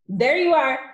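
loud, high triumphant cry; gloating headmistress outburst; Trunchbull. A narrator's reading. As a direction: crow at being proved right. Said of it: "cried the Trunchbull, triumphant".

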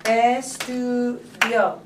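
ESTUDIO broken slowly into separate syllables. Shown as a demonstration. Teacher counting syllables for students, ESTUDIO slowly split up. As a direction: draw out each syllable of "Estudio" with small gaps. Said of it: "how many syllables are in it".

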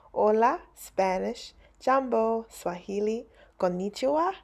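ordinary delivery

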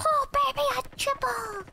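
very high mock-hype squeal; hype catchphrase. Celebrating a kill with a meme line, high voice. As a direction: call it out far above your voice.

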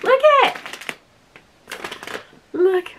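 high, gleeful little cry; excited squeal. Real, not acted. Vlogger showing viewers her new top, excited squeal. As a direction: squeal it to show off.

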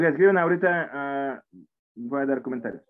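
ordinary delivery

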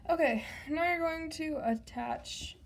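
ordinary talk, nothing marked

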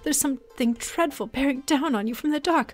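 theatrical seer, hushed then exclaiming; mystic fortune teller. Acted. Seer reads Otter's future in visions, mystical. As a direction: unveil each vision with dramatic wonder.

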